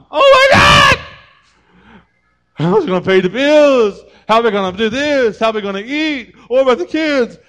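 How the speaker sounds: shrill high panicked shrieking; panicked wife. Pretend. Wife panicking at husband's layoff, high shrieking. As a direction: freak out at the bad news, screaming.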